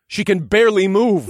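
loud panicked cry; alarmed shout. Acted. Cole alarmed by poisoned Faye.

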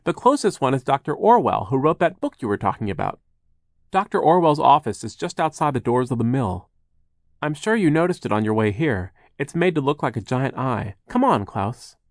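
lightly voiced upbeat helper; helpful Charles. Charles directs Klaus to the eye doctor, helpful.

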